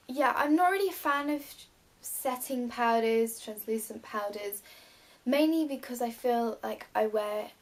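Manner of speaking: ordinary talk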